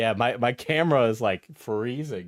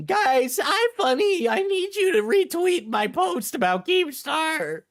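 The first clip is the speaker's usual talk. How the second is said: very high, slow whiny plea; squeaky begging voice; high mock voice; slower than usual.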